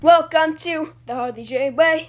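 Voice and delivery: loud, slow announcer intro; hype intro voice. Acted. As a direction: boom it like a show host. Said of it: "Okay, here we go".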